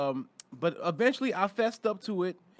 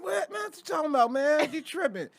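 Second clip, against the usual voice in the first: mock innocence; 9 semitones higher than usual. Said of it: "I feel like".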